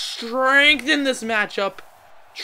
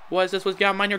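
ordinary talk, nothing marked

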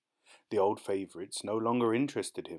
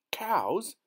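put-on high, shocked question from the boy; shocked cry; 11 semitones higher, 4 dB louder than usual. A narrator's reading. Boy crying out to his aunt in shock. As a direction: gasp it out in disbelief. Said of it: "the boy cried".

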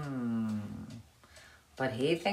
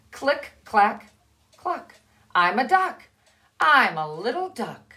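proud little duck; 5 semitones higher, 6 dB louder than usual. Acted. Duckling refrain.

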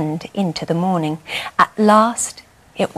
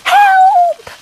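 very high, louder squeak for help; 9 dB louder than usual. A narrator's reading. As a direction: squeal in panic. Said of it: "squeaked Wendell".

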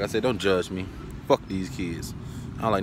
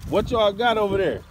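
raised voice, pitched high to carry across the yard; calling out; 11 semitones higher than usual. Real, not acted.

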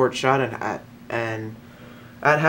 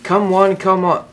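loud carnival barker; announcer call; 7 semitones higher, 6 dB louder, slower than usual. Acted.

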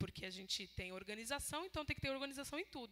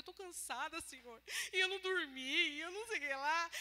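tired complaint; 5 semitones higher than usual. Retold or imitated.

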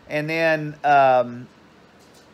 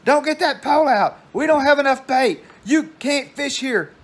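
high-pitched loud mock scream; screamed scolding; 9 semitones higher, 3 dB louder than usual. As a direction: imitate a mother screaming at her son across a parking lot. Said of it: "she would scream at him".

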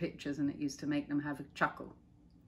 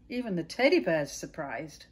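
usual speaking voice

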